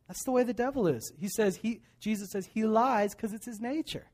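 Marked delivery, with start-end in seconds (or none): none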